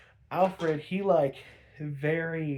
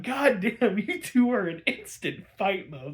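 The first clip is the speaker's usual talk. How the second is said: higher, louder, gleeful disbelief; amazed exclamation; 5 semitones higher, 4 dB louder than usual. Real, not acted. Reviewer marvels at the instant fight, louder and higher. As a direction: cheer on a sudden brawl. Reacting to Alfred and Harriet fighting.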